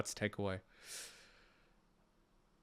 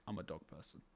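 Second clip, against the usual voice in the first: much softer, self-satisfied under the breath; smug murmur; 9 semitones lower, 20 dB quieter, faster than usual. Retold or imitated. Telling the greyhound story, smug soft murmur. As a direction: say it to yourself, quietly pleased. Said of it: "I'm like".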